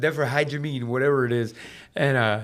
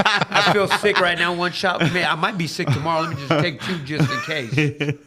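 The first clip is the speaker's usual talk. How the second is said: louder and higher, laughing; 5 semitones higher, 7 dB louder than usual. Real, not acted. Mock-sick joke to the hosts, laughing. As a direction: play along while cracking up. Jokes about dosing NyQuil.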